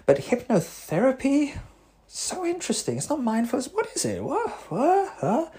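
high, breathless mock amazement; mock gushing wonder. Therapist mocking his own gushing curiosity. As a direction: gush with wide-eyed fascination.

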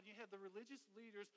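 ordinary talk

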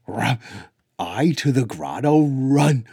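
loud, high and urgent little dog voice; an eager dog shouting run; telepathic doggy voice. Acted. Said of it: "in her telepathic doggy voice".

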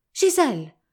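cried out. A narrator's reading. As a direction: call out to a lost love. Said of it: "gridò lui".

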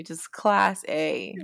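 ordinary talk, nothing marked

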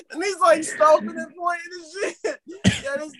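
high, tearful laughter; cracking up mid-sentence; laughing. Real, not acted. Remembering his friend stomping, laughing high. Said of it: "I'm, like, crying in the strip club".

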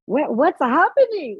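high, slow, startled cry of alarm; alarmed what. Retold or imitated. Recalling the smallpox rash appearing, in high slow alarm. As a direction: rise up high in slow panic. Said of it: "I'm like".

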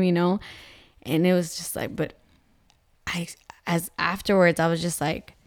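ordinary delivery

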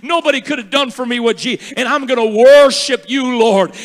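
loud, high, rising cry of devotion; fervent prayer. Retold or imitated. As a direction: pour it out to God at full voice. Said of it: "and you say".

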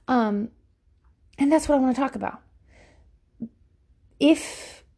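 normal talking voice

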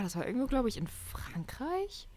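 unsure whispered aside. Real, not acted. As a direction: drop to a whisper. Trailing off uncertain.

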